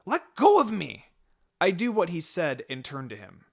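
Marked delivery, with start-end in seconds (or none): 0.0-1.1 high, loud protest; struggling boy. A narrator's reading. Naruto dragged off by the collar, loud high protest. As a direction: yell like a kid being dragged.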